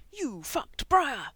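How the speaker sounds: slow, loud, high gleeful crow. A narrator's reading. Frank teasing Mikey about his secret, loud gleeful crow. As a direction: savour the gossip, crowing it out. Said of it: "he crowed".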